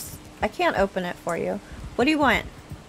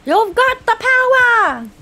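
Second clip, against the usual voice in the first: hype announcer; 12 semitones higher, 6 dB louder, slower than usual. Pretend. Cheering a friend's stamina boost.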